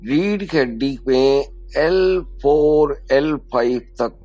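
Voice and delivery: calm guidance, slowing toward the end; guided meditation. Acted. Yoga teacher guiding breath to the spine, slowing meditative voice.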